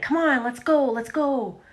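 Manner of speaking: a hint of a nagging parent rushing the kid; impatient parent. Parents hurrying their bullied child, mock impatience. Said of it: "Their parents were constantly like".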